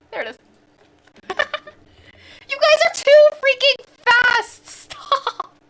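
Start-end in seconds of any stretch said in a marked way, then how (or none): 2.5-5.5 high, loud, slow mock protest; playful yell. Real, not acted. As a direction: shout at chat to stop. Chat solved puzzle too fast.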